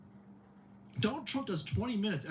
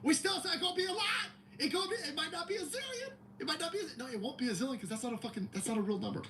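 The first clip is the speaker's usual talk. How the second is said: mock fan; 10 semitones higher, faster than usual. Mock fan answering sir.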